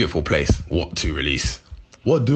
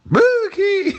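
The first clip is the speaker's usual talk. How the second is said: very high, loud yelp; squealed blurt; 5 dB louder than usual. Real, not acted. Blurting the rumour about certain MCs, a very high loud yelp. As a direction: blurt it out before you can stop.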